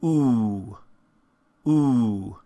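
long, tightly rounded "Ooh"; closed back vowel. Shown as a demonstration. Teacher models the most closed back vowel, pure rounded "Ooh". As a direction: hold a pure "Ooh", lips in a tight circle. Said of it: "this is the most closed of the back vowels".

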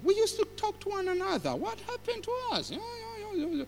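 put-on high elderly voice; older people. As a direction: imitate older folk chattering in a high voice. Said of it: "the older people started talking".

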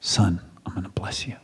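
soft unvoiced whisper, hushed and intimate; whispered promise. Isaac whispering his promise of blessing to Esau. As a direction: breathe the promise like an old father.

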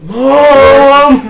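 loud, high holler for mom; whiny little brother. Acted. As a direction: bellow for mom like a kid. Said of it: "how your little brother sounds".

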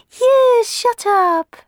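very high, loud squeal echoing her sister; echoing yell. Acted. Tisby backing Clorinda against Cenerentola, high squeal. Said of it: "echoed Tisby".

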